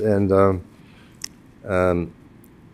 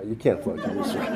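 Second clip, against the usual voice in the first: softer, chuckling through "you can't flunk Hebrew school"; laughing softly; 11 dB quieter than usual. Real, not acted. Telling the rabbi the joke again, softly laughing. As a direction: let the laugh leak in.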